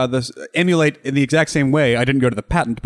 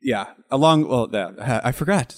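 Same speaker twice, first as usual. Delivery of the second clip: ordinary talk, nothing marked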